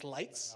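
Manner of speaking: "Leitz" said clearly as lights; Leitz pronunciation. Shown as a demonstration. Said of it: "the correct pronunciation of the company is".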